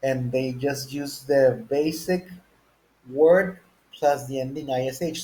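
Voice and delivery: normal talking voice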